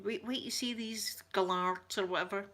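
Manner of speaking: ordinary talk, nothing marked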